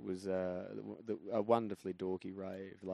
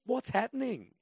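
bewildered; 14 semitones higher, 6 dB louder than usual. Retold or imitated. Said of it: "I've just gone".

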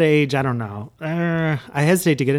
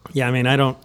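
ordinary talk, nothing marked

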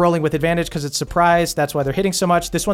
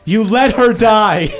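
loud, slow accusing; angry accusation; 4 semitones higher, 6 dB louder than usual. Acted. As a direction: blame him slowly and hard. Jaina accusing.

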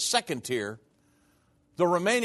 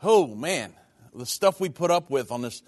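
ordinary talk, nothing marked

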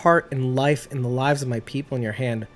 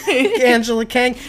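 laughing call-out; 8 semitones higher, 4 dB louder than usual. Pretend. Mock calling out showrunner.

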